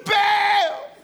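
high, loud mock wail to a god; over-the-top desperate calling; hammed-up crying out. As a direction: holler the name, stretched out and breaking into pieces. Prophets crying louder to Baal.

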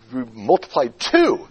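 "to" stretched and rising; slow down and hang on "to"; long, lifted "to" after "multiplied"; stress on TO. Shown as a demonstration. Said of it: "that's a directional word".

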